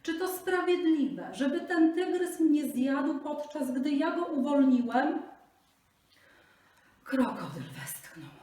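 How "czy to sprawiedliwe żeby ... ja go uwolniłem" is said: high, loud desperate plea; pleading Brahmin. Acted. Brahmin begs the buffalo for justice, high and pleading. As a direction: beg for your life, voice raised high.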